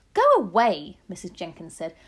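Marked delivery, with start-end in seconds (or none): 0.1-1.0 very loud, higher command; angry dismissal. A narrator's reading. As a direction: shoo her off loudly. Wife angrily dismisses grandmother.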